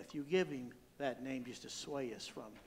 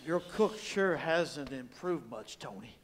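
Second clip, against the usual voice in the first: slow tough cop voice; 7 dB louder, slower than usual. Acted. Cop taunts the mob boss.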